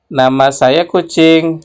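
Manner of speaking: usual speaking voice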